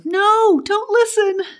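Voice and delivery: very high distressed woman's cry; high-pitched, upset outburst. A narrator's reading. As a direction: cry out in a high panicked plea. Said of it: "Betty cried louder".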